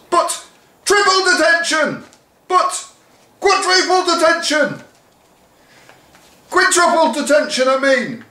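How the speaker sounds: very high, loud shrieks of detention; frantic teacher counting up detentions; escalating shouts. Acted. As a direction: keep topping the last punishment.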